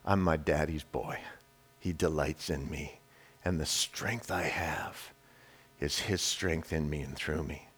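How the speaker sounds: low, soft, settled voice; a man secure in his father's love; quiet confidence. Acted. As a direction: say it calmly, sure of it. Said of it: "They know who they are".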